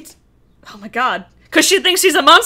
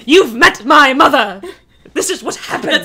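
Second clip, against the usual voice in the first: hammed-up, upset British accent; 3 semitones higher, 5 dB louder than usual. Kylo explaining how his family talks when upset, British accent. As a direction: play indignation in a put-on British accent. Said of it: "He'll be just suddenly British".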